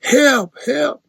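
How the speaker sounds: high yelping help help as he's robbed back; mock cry for help. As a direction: play the conman yelping for help. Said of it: "he's screaming the whole time".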